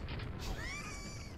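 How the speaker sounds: a squeaky high burst of disbelieving laughter. Real, not acted. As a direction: laugh at the absurdity. Baffled by troll map.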